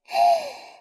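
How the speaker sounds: short high giggle; a bright little laugh at his red face; teasing giggle. Acted. Older sister laughs at the boy's red face, giggle.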